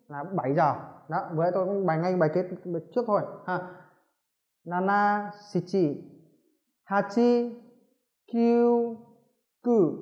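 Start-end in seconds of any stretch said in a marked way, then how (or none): 4.7-9.9 each number said alone after a pause; teacher reading numbers one by one; slow counting drill. Shown as a demonstration. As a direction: count aloud for students to repeat. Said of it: "tôi sẽ đọc".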